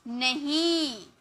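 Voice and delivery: नहीं said slowly with the हीं held long; drawn-out हीं. Shown as a demonstration. Teacher shows the long ई sound in नहीं to pupils. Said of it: "लंबा में pronounce हो रहा".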